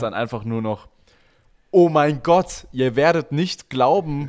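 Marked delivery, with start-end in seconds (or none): 1.7-4.3 high, loud hyped vlogger intro; overhyped YouTube drama opener; clickbait YouTuber voice. Retold or imitated. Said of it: "Wir können auch in die YouTube-Zeit gehen".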